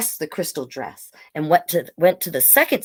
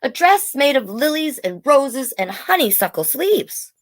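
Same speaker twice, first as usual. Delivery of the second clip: put-on higher, dreamy little kid voice; child describing a dream dress; 7 semitones higher than usual. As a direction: describe it like a wide-eyed child. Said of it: "Bailey said".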